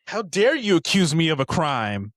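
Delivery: high, loud shrill-free indignant cry; hammed-up outrage. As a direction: play her offended and shocked. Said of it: "she was like".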